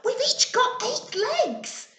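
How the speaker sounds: put-on thrilled child spider; excited. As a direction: announce it with glee. Spinderella excited at answer.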